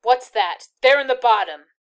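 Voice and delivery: loud, sharp sudden question; tense up and demand it. A narrator's reading. Soldier spotting the packet, tense and loud. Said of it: "in a different, tenser voice".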